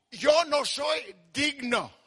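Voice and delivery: very slow, halting confession; humble confession. Acted. Returning son to his father, slow humbled confession.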